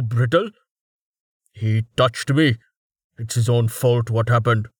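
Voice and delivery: a bully who blames others; arrogant brute voice. A narrator's reading.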